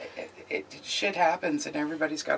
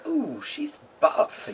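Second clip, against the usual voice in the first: swooning admirer; 10 semitones higher, much slower than usual. Retold or imitated. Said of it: "Jared's like".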